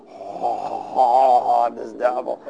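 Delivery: mock spooky devil voice, drawn-out Oh; movie devil. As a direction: ham up a film devil's ghostly entrance. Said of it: "movies that always have this".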